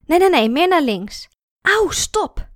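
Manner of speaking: loud, urgent instructions; urgent shouting. Acted. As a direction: shout at him to stop. Couple struggling with wheelchair.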